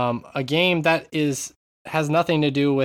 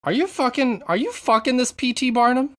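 jealous lover; 9 semitones higher than usual. Pretend. Mock jealous partner joke.

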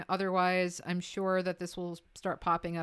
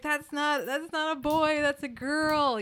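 higher-pitched scandalised discovery; mock shocked crowd; 7 semitones higher than usual. Imitating the audience spotting a girl prince, mock astonishment. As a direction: point out the scandal as a stunned onlooker. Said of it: "the entire crowd goes".

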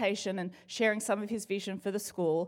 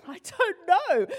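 higher, slow words through a laugh; 8 semitones higher, 4 dB louder, slower than usual. Real, not acted. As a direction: giggle out the answer. Laughing after the yawn joke.

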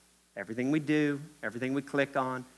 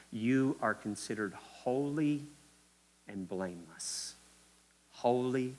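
usual speaking voice

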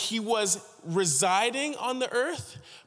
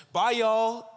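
put-on louder, higher American twang in Idaho accent; imitated Idaho accent; 5 semitones higher, 5 dB louder than usual. Departing intern calls goodbye, imitated Idaho accent. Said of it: "strong, like, Idaho accent".

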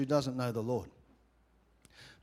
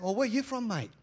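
higher-pitched imitation of a chatty bloke; curious stranger; 6 semitones higher than usual. Sauna stranger asking about the accent, mimicked curiosity. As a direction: mimic a nosy stranger's question.

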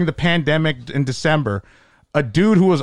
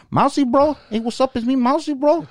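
much higher, lazy-friendly dealer voice; old heroin dealer; 11 semitones higher than usual. Retold or imitated. Comedian imitating his old heroin dealer, much higher voice. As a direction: call out like a street dealer greeting a regular. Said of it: "he sounded like my, my, my old heroin dealer".

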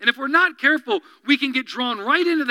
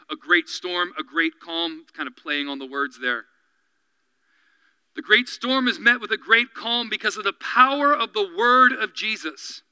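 ordinary delivery

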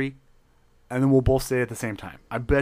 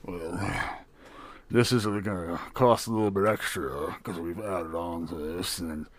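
low gruff barkeep; 7 semitones lower, 4 dB quieter than usual. Acted. Barkeep charging extra.

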